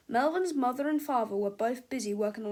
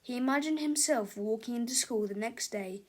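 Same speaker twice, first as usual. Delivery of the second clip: ordinary delivery, nothing marked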